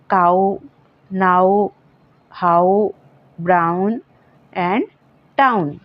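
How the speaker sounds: काऊ नाऊ हाउ ब्राउन town with an au glide. Shown as a demonstration. Teacher showing students ow words that glide to au. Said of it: "आउ की sound भी देता है".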